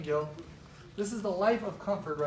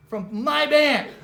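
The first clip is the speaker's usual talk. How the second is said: slow, louder, higher punchline; drawn-out exclaim; 6 semitones higher, 10 dB louder, much slower than usual. Real, not acted. To the audience about being fired, a slow loud punchline. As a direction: stretch out the last words for a laugh.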